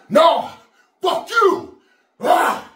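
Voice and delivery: full-force defiant yell, high and loud; shout. Shown as a demonstration. Gym lifter shouting defiance at his tired body, roaring. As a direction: scream it back at your own body. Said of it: "But you know what we do? Say".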